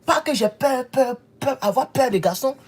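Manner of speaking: usual speaking voice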